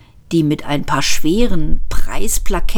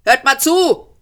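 raised, higher shout; bellowing; 7 semitones higher than usual. A narrator's reading. Ford yells his complaint across to the police, raised and high. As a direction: holler across the room, pleading. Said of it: "Ford brüllte los".

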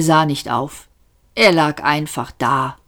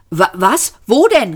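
stammered, then pitched sharply up; startled call; 5 semitones higher, 4 dB louder than usual. A narrator's reading. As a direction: call out, caught off guard. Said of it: "rief Arthur und fuhr herum". (aufgeschreckt gerufen, am Ende hoch)

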